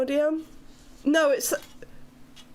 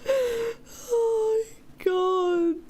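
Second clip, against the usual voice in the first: tearful laughing; 6 semitones higher, much slower than usual. Real, not acted.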